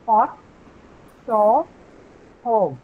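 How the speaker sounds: pot, saw, hog, each word said slowly and cleanly; teacher modelling vowels word by word; model vowel demo. Shown as a demonstration. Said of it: "so listen to this".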